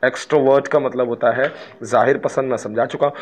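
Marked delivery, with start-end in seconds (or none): none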